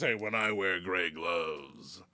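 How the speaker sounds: low, slow, drawn-out announcement; mock wrestler voice. Wrestler's message to the fans, low and mock-solemn. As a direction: intone it deep and slow.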